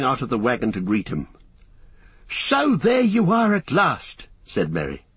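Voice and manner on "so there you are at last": put-on young hobbit voice. Merry voiced in story.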